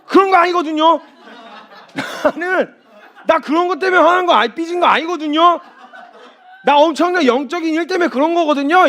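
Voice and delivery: high, loud, laughing huffy protest; mock indignant denial. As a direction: play a sulky person insisting they're not sulking. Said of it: "이 말을 또 되게 싫어해요".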